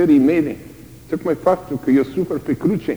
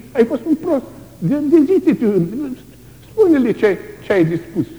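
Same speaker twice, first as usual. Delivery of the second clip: higher, taunting voice of doubt; mocking inner voice; 9 semitones higher, 4 dB louder than usual. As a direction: taunt the martyr. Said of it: "niște gânduri de care e groază". (voce batjocoritoare, mai înaltă)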